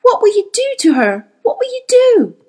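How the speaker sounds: high, loud desperate question; frantic wail of horror; horrified cry. A narrator's reading. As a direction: cry out in dread. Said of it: "she tried to cry out".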